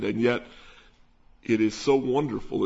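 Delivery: ordinary delivery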